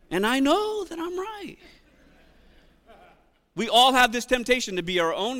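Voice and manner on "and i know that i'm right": high, smug "know"; much higher mock confidence; mock self-certainty. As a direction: play it smug and sure.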